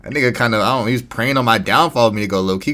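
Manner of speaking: ordinary talk, nothing marked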